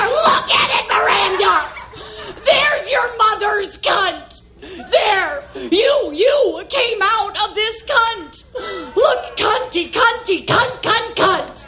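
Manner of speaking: high shrieking meltdown, some words dragged out; unhinged rage. Acted. Mother shouting at daughter in the theater, high hysterical rage. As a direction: lose all control, shouting high at your child. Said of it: "unconscious rage".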